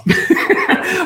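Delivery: sudden high airy laugh. Real, not acted. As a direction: bursts out laughing at his son. Son asks for hot dog.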